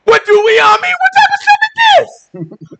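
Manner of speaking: very high, frantic mock shout; crying out. Moses trapped at the sea, crying out to God. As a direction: cry out to heaven in protest. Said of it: "he cried out to the Most High God like".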